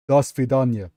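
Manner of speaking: punchy Russian accent. Shown as a demonstration. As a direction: warm up a Russian accent with a firm push. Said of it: "read it in the Russian accent".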